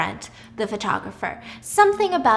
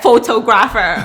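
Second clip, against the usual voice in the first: mock proud title; 8 dB louder than usual. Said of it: "He's like".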